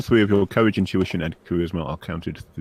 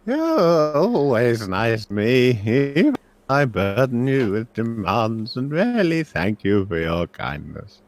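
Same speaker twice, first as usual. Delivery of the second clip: put-on high, slow elderly voice; drawn-out, grateful old magister; sheepish old professor; 5 semitones higher, 4 dB louder, slower than usual. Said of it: "smiles sheepishly, saying".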